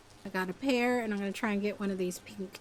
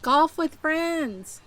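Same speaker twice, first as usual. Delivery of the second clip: playful exclaim; 8 semitones higher, 7 dB louder, much slower than usual. Real, not acted. Random exclamation, drawn out.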